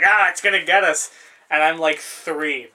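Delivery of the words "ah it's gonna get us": louder, teasing fake alarm; big brother hamming up the danger; mock scare. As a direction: pretend the log is attacking to scare the kid. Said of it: "he's like".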